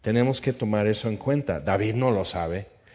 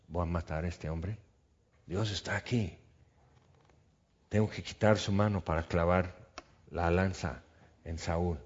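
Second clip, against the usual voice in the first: low, hushed voice, much softer; hushed plotting; 4 semitones lower, 7 dB quieter than usual. Acted. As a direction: murmur it as if beside a sleeping king. David tempted beside sleeping Saúl.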